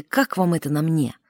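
ordinary talk

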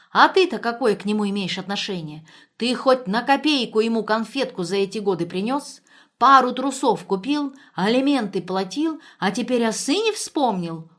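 громко, с напором, возмущённая бывшая жена; засыпать упрёками в повышенном тоне; гневный упрёк. A narrator's reading. Бывшая жена нападает на Дементьева. (возмущённо, напористо)

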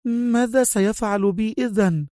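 high, astonished woman's question. A narrator's reading. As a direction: ask high, taken aback. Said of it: "هتفت في دهشة".

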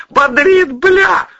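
very high, loud howl; shrieked bravado at full volume; yelling from icy water. A narrator's reading. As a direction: howl from the shock of cold water. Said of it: "вопил он".

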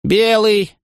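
high ringing announcement; proclaimed result. A narrator's reading. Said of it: "объявил писец".

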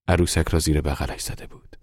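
ordinary delivery, nothing marked

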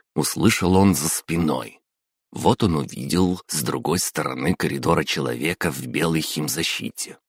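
usual speaking voice

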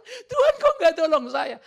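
high, loud mock whine, laughing and crying; mock whining to God. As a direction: play a spoiled complainer wailing at God. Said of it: "Udah punya mobil ada persoalan". (rengekan manja pura-pura menangis)

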